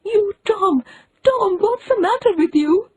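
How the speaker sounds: put-on high, breathless frightened old woman; gasping aunt. A narrator's reading. Aunt at Tom's bedside, high frightened gasp. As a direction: gasp it out in alarm. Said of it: "she gasped out".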